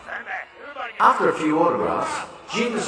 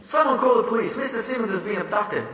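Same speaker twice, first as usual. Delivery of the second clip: mild mock yell; 10 semitones higher than usual. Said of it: "yelling and screaming".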